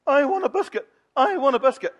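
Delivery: put-on high, loud nagging child voice; whiny child demand. Shown as a demonstration. Minister shows a boy how to nag mummy, whiny and loud. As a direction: whine it like a child wanting a treat. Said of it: "to do this".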